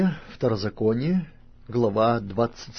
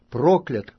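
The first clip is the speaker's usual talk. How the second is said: louder, falling solemn word; loud proclamation; 4 semitones higher, 7 dB louder than usual. A narrator's reading. Levites pronouncing a curse to all Israelites, loud proclamation. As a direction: announce the curse firmly. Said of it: "скажут всем израильтянам громким голосом".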